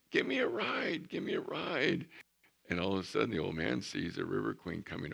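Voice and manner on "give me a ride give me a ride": high eager calling; boy calling out. Retold or imitated. Small boy hailing the steamboat, high and eager. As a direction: call out to the passing boat, pleading. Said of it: "boy keeps calling out".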